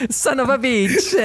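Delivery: hammy Italian gangster voice; Italian accent. Pretend. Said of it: "ins Italienisch switchen".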